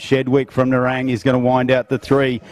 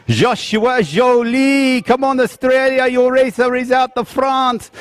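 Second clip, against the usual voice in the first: mock French accent, building from announcer pitch to a much higher, louder cheer; over-the-top French accent, slowing as the cheer peaks; 12 semitones higher, 3 dB louder, slower than usual. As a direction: ham it up like a French race caller hyping the crowd. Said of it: "French accent, sounding great".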